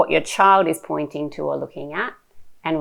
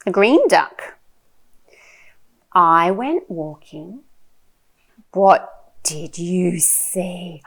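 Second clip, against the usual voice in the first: animated, slow picture-book reading with big swoops; reading to a toddler; 4 semitones higher, 6 dB louder, much slower than usual. Shown as a demonstration. Mum sharing a picture book with her little one, animated child-directed reading. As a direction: draw out the words, playful and full of wonder.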